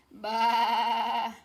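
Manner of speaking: loud bleating baa like a sheep. Acted. Teacher showing a child how a sheep says baa, loud bleat. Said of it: "he's saying".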